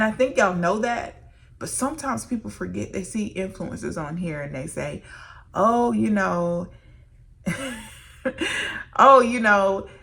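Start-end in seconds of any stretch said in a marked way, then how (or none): 5.5-9.9 losing it mid-sentence, louder; laughter breaking through the quote; laughing. Real, not acted. Mocking what people say about influencers, breaking into laughter. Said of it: "I can't even talk".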